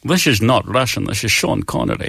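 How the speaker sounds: slushy s sounds, measured and low; Sean Connery. Acted. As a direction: play it as a cool secret agent. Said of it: "started off as Sean Connery".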